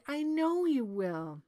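high, drawn-out princess insistence; slow, high, doubting protest; distrustful princess. A narrator's reading.

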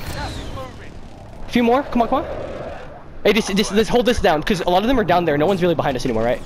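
ordinary talk

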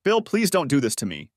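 a touch of wheezy crying, understated; tearful pleading. A narrator's reading. Wife begs husband not to leave her, mildly tearful. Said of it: "she wheezed through her tears".

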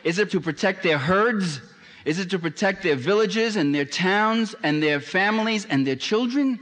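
pressing, high-pitched rhetorical questions; rhetorical questioning. Acted. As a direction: pile up the questions in a high, insistent voice. Rhetorical questions put to the audience.

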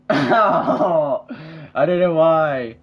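high, loud, drawn-out laughing words; laughing loudly. Real, not acted. Friend asked about his missed kick, laughing out loud. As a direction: laugh helplessly through the words.

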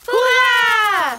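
loud happy cheer. Acted. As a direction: shout for joy. Cheering at the recipe.

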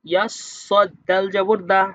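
ordinary delivery

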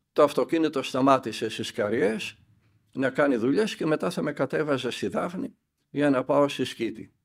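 ordinary talk, nothing marked

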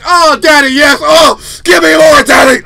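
hammed-up pleasure screaming; very loud, high mock-ecstatic cries; good scream. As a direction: shout it as exaggerated bedroom pleasure. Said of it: "that's good".

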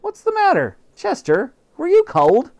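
high, loud doting mother voice; high fussing housewife; fussing mom. Acted. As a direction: coo at the cat like a worried mom. Mother fusses over the cat.

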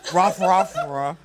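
loud barking like a dog; playful dog barks; mock dog barking. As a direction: bark it out like a dog. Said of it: "sexy talk I do in the bedroom".

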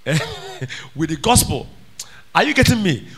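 normal talking voice

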